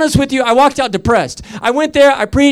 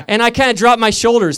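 normal talking voice

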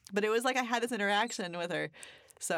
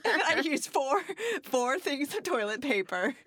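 higher and louder, giggling as she tells it; giggly, higher, louder telling; laughing through it; 8 semitones higher, 4 dB louder than usual. Real, not acted. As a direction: laugh at her own embarrassing detail. Laughing at own nervous details.

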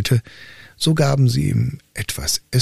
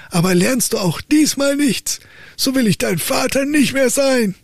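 father raging at his son, high and angry; angry old count; 13 semitones higher than usual. Acted. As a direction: rage at the worthless son. Father in a rage.

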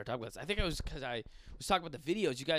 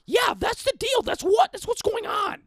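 loud, high cartoon mother arguing; imitating mom's combative squawk; argumentative mom; 12 dB louder than usual. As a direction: agree as if in a fight. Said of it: "talking like we're arguing".

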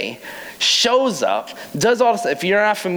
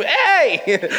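excited shout; 9 semitones higher than usual. Acted. Acted post at the tomb.

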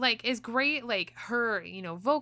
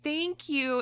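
high, slow gushing thanks, lightly voiced; sweet idol voice; 8 semitones higher, slower than usual. Idol thanking a fan for singing, high and gushing. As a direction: coo it like a touched idol.

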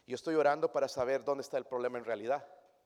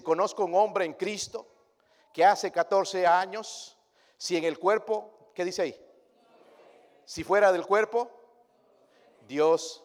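slow, loud solemn reading; scripture reading; 5 semitones higher, 9 dB louder, slower than usual. Acted. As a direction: read the verse with weight. (lectura bíblica lenta y solemne)

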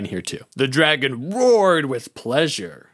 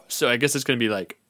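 usual speaking voice

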